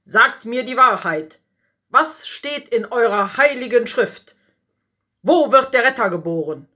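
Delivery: harsh king barking orders at priests; commanding. A narrator's reading. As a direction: demand the truth like a tyrant. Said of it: "befahl ihnen".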